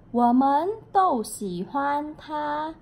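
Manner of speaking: slow Mandarin read of Wo men dou xi huan ta; Mandarin example sentence. Shown as a demonstration. Teacher reads a Chinese sentence to students, slow. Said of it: "Chinese example sentence read".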